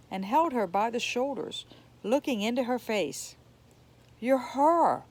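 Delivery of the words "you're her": startled, louder gasp of recognition; astonished. Acted. Woman astonished, recognizing Serafina. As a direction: say it as if seeing a ghost. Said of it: "the woman said in astonishment".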